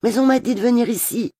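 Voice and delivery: high-pitched plaintive protest; plaintive insistence. A narrator's reading. Confused newcomer protests to the chief makeup artist, plaintive. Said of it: "en insistant d'une voix plaintive".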